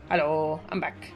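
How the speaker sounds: normal talking voice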